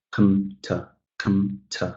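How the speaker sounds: short, soft "com-, t-" with reduced vowels; tiny weak beats on "com-" and "t-"; unstressed syllables. Shown as a demonstration. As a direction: drop "com-" and "t-" low, quick and quiet. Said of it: "these are pretty short".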